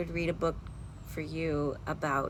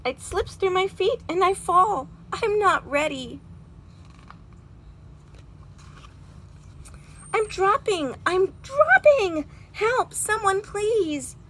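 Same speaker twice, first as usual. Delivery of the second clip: high, loud, frightened wail; panicked falling cry; 12 semitones higher, 5 dB louder, slower than usual. Acted. Little robin falling from the branch, calling for help in panic. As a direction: cry out as you plunge.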